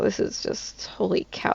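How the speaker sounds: whispered holy cow, worn out; breathy exasperation. Real, not acted. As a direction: sigh it out, drained. Overwhelmed by the bad week.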